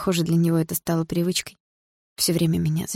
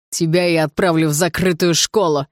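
loud, impotent fury; angry yell; 5 dB louder than usual. A narrator's reading. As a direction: threaten loudly in helpless rage. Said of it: "заорал отчим в злом бессилии". (злобный крик от бессилия)

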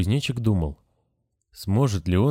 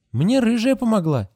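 put-on much higher child voice, a bit louder; innocent child; 14 semitones higher, 4 dB louder than usual. A narrator's reading. Grasshopper tells the nurse who helped, high and innocent. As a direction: state it plainly like a small boy. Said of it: "Grasshopper explains".

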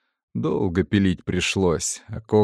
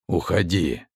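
whispery telepathic voice; 3 semitones lower than usual. Acted. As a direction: dismiss him from inside his head. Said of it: "Голос раздался в голове".

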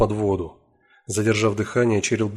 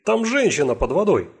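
high, loud urgent call; cry for help; 9 semitones higher, 4 dB louder than usual. Acted. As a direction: yell for help across the pond. Man calls for help.

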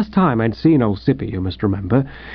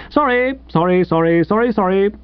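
high, breezy string of apologies; jovial; 6 semitones higher than usual. Acted. Late editor apologizes to his annoyed visitor, jovially. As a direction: toss off the apology merrily. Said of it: "said Sippy jovially".

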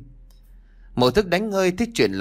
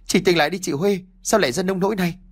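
high, pleading call to wake up; desperate pleading; 7 semitones higher, faster than usual. A narrator's reading. Sister begs her dead sister to wake, desperate. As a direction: beg her to open her eyes. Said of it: "Xuyến gào lên".

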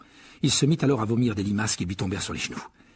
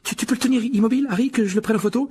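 put-on high, breathy, stammering young fan; agitated young boy; 13 semitones higher, 5 dB louder, faster than usual. A narrator's reading. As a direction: pelt out anxious questions in a high child's voice. Voices excited boy Colin. (garçon surexcité, voix aiguë et haletante)

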